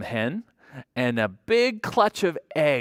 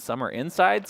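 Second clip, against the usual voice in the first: ordinary delivery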